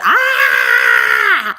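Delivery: sudden sharp shout of alarm; retold parent yell. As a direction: bark a startled warning at the child. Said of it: "And the parent or caregiver yells at".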